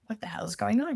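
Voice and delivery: mock-baffled husband voice, lightly voiced; flustered husband. Husband walks in on crying mom and baby, baffled. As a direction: play the lost, helpless husband with a laugh. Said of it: "and he's like".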